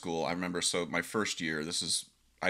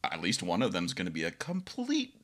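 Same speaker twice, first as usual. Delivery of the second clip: higher, lightly mocking tone; amused realisation; 6 semitones higher than usual. Meeting the band, amused wry lift. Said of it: "and I'm like".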